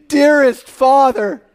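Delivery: Dearest Father, drawn out slow, loud and high; fervent prayer cry. Shown as a demonstration. Preacher voices the prayer cry.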